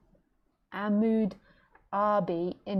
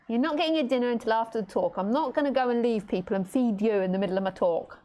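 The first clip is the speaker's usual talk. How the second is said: normal talking voice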